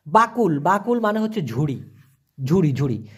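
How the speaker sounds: usual speaking voice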